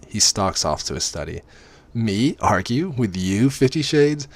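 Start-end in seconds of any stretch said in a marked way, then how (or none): 1.9-4.3 slow, higher-pitched mock-incredulous question; mock disbelief. A narrator's reading. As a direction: draw it out in sarcastic disbelief. Heroine's sarcastic inner retort to order.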